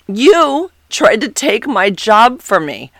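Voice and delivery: slow, loud, pointed accusation; pissed. Retold or imitated. Coworker accusing the narrator of stealing her job, pissed. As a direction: lay the blame down slowly. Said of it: "Sounds really pissed".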